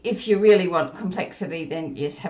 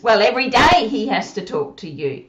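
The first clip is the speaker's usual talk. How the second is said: ordinary talk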